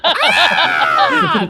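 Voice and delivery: high, loud laughing scream; just scream it out, high and loud. Acted. Game master voicing creatures that just scream, screaming. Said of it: "They just scream".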